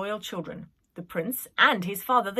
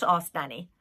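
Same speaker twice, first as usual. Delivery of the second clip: plain reading voice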